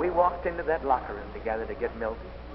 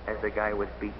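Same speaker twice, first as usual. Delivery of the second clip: ordinary delivery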